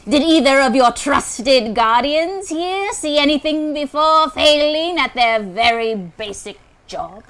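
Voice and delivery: slow, loud villain reading; dramatic character voice. Acted. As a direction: boom it out slowly like a warlord. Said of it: "Try and do the voice".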